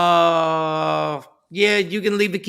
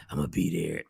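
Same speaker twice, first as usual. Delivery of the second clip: low, soft, slurred sleepy mumble; groggy drugged voice; 12 semitones lower, 12 dB quieter, faster than usual. Retold or imitated. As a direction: sound doped up on painkillers. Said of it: "this is the voice".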